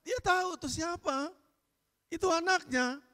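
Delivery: high, loud ringing sermon voice; fiery preaching. Acted. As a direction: preach it out with full force. Preacher's peak fervour.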